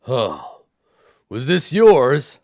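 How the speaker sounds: booming giant asking the little fox. Acted. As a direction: ask it like a giant nudging a squashed tent. Giant voice continues.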